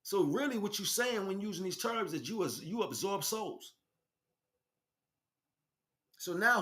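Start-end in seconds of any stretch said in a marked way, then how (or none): none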